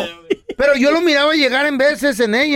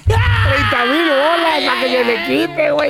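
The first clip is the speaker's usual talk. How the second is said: laughing; 5 semitones higher than usual. Real, not acted. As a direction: shout it through laughter. Laughing at caller's bad luck.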